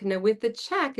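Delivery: ordinary talk, nothing marked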